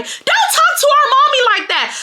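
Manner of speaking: very high mock kid yell; imitated sixth graders. Kids defending their mommy, high-pitched mock shout. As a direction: pipe it up high like indignant children. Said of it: "the fifth and sixth graders".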